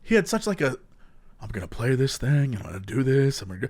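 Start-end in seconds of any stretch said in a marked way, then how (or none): 1.4-3.7 streamer's sleepy voice. Retold or imitated. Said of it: "he had such a great voice".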